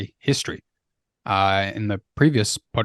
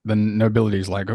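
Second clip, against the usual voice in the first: ordinary talk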